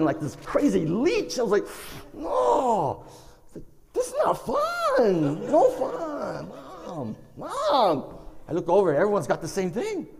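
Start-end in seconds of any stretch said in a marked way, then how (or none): 2.1-8.2 high, drawn-out whimpering wail; mock crying. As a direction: whine tearfully for mom, hammed up. Suffering under the suction cups.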